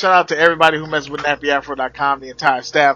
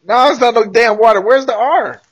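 exasperated objection; 8 semitones higher, 5 dB louder than usual. Real, not acted. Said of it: "objecting to the missing R".

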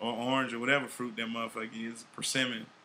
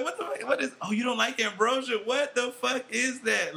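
disgusted surprise; 9 semitones higher than usual. Retold or imitated. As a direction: recoil at the green salad, pitched way up.

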